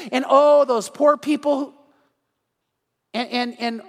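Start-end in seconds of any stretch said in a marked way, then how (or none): 0.3-1.7 drawn-out, higher, louder mock sympathy; mock pity. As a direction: parody the preachy TV character, slowly. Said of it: "characters on there that talk about".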